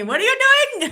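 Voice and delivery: put-on loud, high botched Russian accent, half laughing; bad Russian accent. Imitating a viewer calling in alarm, bad Russian accent. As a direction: yell in a badly faked Russian accent. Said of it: "that was not a Russian accent".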